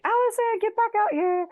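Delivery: high-pitched mock yell at normal volume; imitated angry yell. Boyfriend ordering girlfriend back outside after overhearing her, mock angry yell. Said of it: "probably to just yell at me some more".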